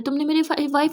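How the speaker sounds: ordinary delivery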